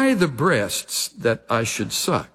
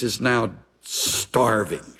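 ordinary delivery

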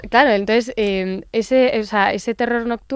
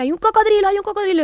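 high-pitched, loud alarmed shouting; retold child's screaming; 13 semitones higher, 6 dB louder, faster than usual. Mother imitates her son's night terror, shouting in alarm. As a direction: cry out like a child seeing things at night. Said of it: "ah, que me decía".

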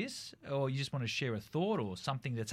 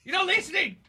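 much higher, loud exasperation; exasperated shout; 9 semitones higher, 16 dB louder than usual. Real, not acted. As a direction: cut him off loudly. Talking over persistent caller.

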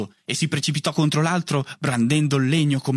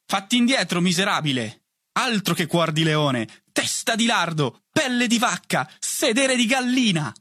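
high, theatrical name-calling; taunting insults; 7 semitones higher than usual. A narrator's reading. Boys trading insults in a stilt duel, high escalating taunts. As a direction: fling each insult higher, building.

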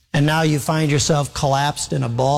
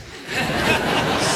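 laugh; 10 semitones higher than usual. Real, not acted. Preacher laughs at story twist.

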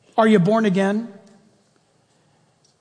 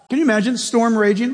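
ordinary talk

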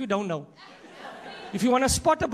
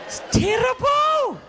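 very high, drawn-out wail; exaggerated mock wail; 11 semitones higher, 4 dB louder, slower than usual. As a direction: stretch it into a big high cry. Mock lament, bigger repeat.

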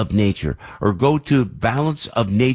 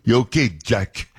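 imitating the clerk. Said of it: "You know, like that".

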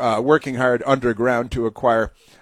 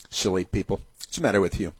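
ordinary delivery

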